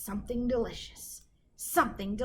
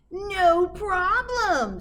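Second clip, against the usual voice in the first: slow, drawn-out, louder witch; 9 semitones higher, 8 dB louder, much slower than usual. A narrator's reading. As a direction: stretch it out smugly like a confident witch. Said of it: "said Gritch".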